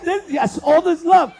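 normal talking voice

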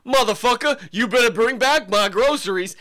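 loud, high angry yell; shouting Samuel L. Jackson. Retold or imitated. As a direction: bellow it like an action hero. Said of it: "He goes".

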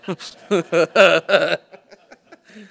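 big rising burst of laughter; loud laugh. Real, not acted. To the audience, after the doctor story, a loud laugh.

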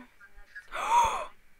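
big, high gasp of real shock; loud sharp intake of breath. Real, not acted. Streamer hearing news about Technoblade, shocked gasp.

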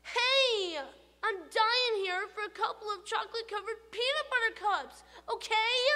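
high, loud pleading tooth voice; the tooth whining for candy; begging tooth. Acted.

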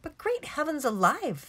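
high-pitched outraged exclamation; high, agitated cry of fury; furious shout. A narrator's reading. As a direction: explode with indignation. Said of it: "shouted, jumping up and down with fury".

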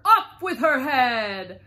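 loud, high, drawn-out yell; shouting. A narrator's reading. The Queen orders another player executed, shouting. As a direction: bellow it like an imperious queen. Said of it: "arguing with the other players and shouting".

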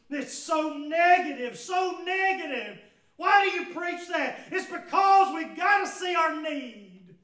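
loud, high, impassioned preaching; preaching at full force. Acted. Preacher pressing the woman's confession on the congregation, impassioned. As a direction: drive each word home to the congregation.

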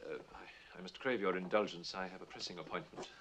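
low, soft, hesitant apology; subdued excuse. Acted. Critic excusing himself from his audience, subdued and hesitant. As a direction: drop the voice and back out of the speech.

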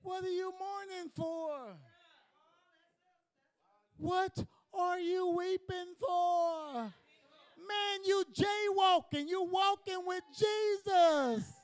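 high, loud, slow shouted preaching; full-force preaching. Acted. Preacher rousing the congregation with a slow, loud, high shout.

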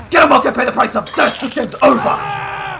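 loud high shout, dragged out at the end; gloating battle cry. Pretend. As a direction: build to a long victory yell. Shouted catchphrase to finish opponent.

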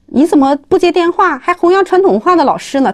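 high-pitched mock telling-off; retold scolding. Husband scolds wife for not answering his call, retold indignant scolding.

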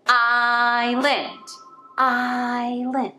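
"Island" split into two slow clapped beats, IS-land; clapping syllables. Shown as a demonstration. Teacher clapping syllables of island for the class. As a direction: clap out "Island" beat by beat. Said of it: "let's clap island".

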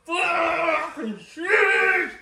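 slow, high, crying shout; anguished shout. Real, not acted. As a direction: drag it out in pain. Burning pain, crying out.

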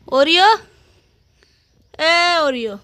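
high, loud sing-song call to the pet; playful loud wake-up call to Oreo; calling the dog. Real, not acted.

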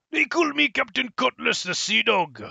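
pirate voice. Acted. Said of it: "pirate captain introduction".

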